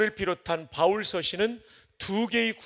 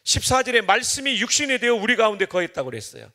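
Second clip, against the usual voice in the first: preaching at full force; 4 semitones higher, 6 dB louder than usual. Real, not acted.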